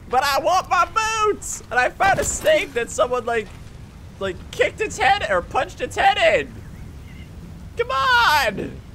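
high, loud, drawn-out whining yell; shouted protest. Real, not acted. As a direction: complain at the top of your voice. Protesting loss of snake boots.

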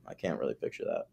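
usual speaking voice

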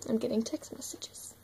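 whispered aside; soft whisper. Real, not acted. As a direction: hush to a whisper. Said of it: "whispered aside".

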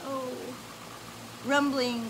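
ordinary delivery, nothing marked

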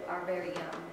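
usual speaking voice

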